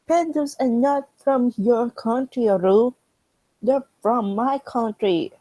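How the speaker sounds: high, loud, slow scolding; indignant scolding. Acted. China telling Korea off about pandas, indignant. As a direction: put Korea firmly in place.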